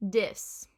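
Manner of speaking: "Dis" for this, starting on a D; Cockney D for TH. Shown as a demonstration. Teacher showing viewers this as Cockney "Dis". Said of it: "pronounce with a D".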